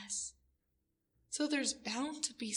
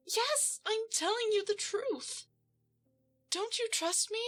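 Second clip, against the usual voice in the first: much higher, louder anxious insistence; nervous pleading lie; 8 semitones higher, 4 dB louder than usual. Acted. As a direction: convince him, scared of being caught. Lying nervously to captor.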